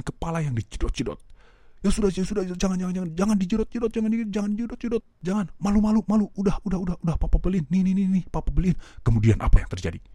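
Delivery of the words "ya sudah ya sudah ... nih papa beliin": high, hurried pleading to stop; high anxious father; frantic giving in. Retold or imitated. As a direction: beg the child to stop in a panic.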